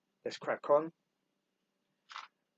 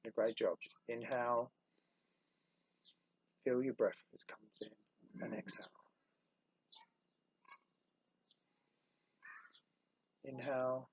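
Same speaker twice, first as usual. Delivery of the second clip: soft, unhurried breathing guidance; guided meditation; 8 dB quieter than usual. Real, not acted. Instructor guiding listeners through inhale and exhale, soft meditation voice. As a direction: quietly coach the breath, letting the words fade.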